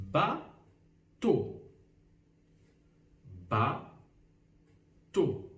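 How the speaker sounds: Bateau as two beats with a long gap; split into syllables. Shown as a demonstration. Teacher gives another syllable example, "Bateau" slowly split. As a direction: say "Bateau" as ba, pause, teau. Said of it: "un autre exemple".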